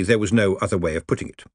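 ordinary delivery, nothing marked

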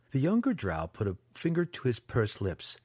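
ordinary delivery

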